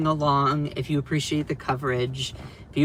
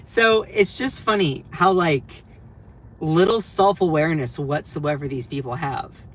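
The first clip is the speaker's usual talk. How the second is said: normal talking voice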